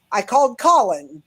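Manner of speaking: ordinary delivery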